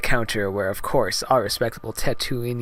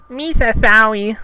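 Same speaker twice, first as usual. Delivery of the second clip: high, loud cartoon alien voice; Jar Jar Binks; 12 semitones higher, 9 dB louder than usual. Gungan stumbles in clutching his tongue, high loud cartoon voice. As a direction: squeal it with a lisping tongue. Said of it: "said Jar Jar".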